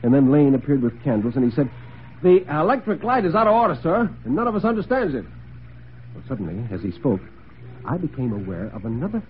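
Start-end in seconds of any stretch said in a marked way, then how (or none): 2.2-5.2 high-pitched servant voice; butler, apologetic. Acted. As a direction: report trouble deferentially in a much higher voice. Plays Lane the butler.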